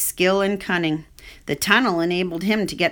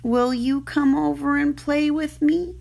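lightly higher, pleading pig voice; hopeful young pig; 7 semitones higher than usual. Acted. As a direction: ask shyly, hoping for a friend. Wilbur voiced in story.

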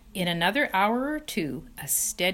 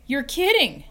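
surprised; 8 semitones higher, 6 dB louder than usual. Acted. Wilbur amazed at the number.

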